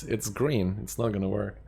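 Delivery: ordinary delivery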